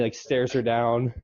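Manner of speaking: normal talking voice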